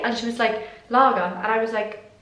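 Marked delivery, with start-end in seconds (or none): 0.9-1.2 imitated English accent, a single louder word. Customer repeating her order to the waitress, imitated English accent. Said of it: "she had an English accent".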